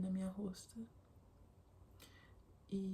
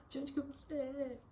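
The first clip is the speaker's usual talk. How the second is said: imitating the mother, asked even higher; a delighted repeat of the question, pitched up; excited question while hugging; 7 semitones higher than usual. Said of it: "Ela me abraçou".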